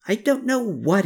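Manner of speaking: high, mildly exasperated groan. A narrator's reading. Woman baffled by Klaus's doings, groaning. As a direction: groan lightly at not knowing. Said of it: "groaned Gertrude".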